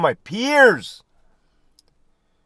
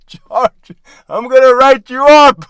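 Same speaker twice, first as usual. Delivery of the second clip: loud, high, outraged mock boss; indignant boss; 7 semitones higher, 9 dB louder than usual. Manager scolding a guard who questioned him, loud and mock outraged. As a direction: huff it like an offended manager.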